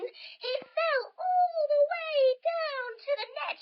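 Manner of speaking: high, loud and slow falling cry; dramatic fall. A narrator's reading. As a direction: let the voice tumble with him.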